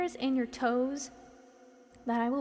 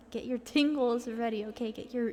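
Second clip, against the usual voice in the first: ordinary talk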